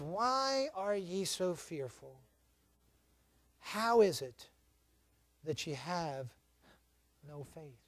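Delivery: hushed, slow, measured questioning; gentle rebuke. Retold or imitated. Jesus asking fearful disciples about their faith, gentle rebuke. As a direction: drop low and soft, with long pauses.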